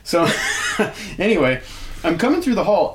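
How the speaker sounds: usual speaking voice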